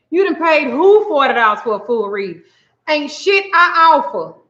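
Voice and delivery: mock outrage, pitch climbing; indignant disbelief. Reader rejects a forty-dollar reading claim, rising and indignant. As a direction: question it, then climb high to refuse.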